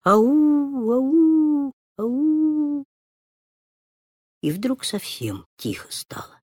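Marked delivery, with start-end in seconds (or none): 0.0-2.9 fading call. Acted. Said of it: "Всё тише и тише её голос".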